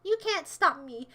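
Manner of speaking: usual speaking voice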